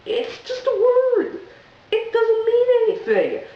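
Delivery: exasperated squeak. Real, not acted. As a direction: plead the obvious in a strained high voice. Exasperated high insistence.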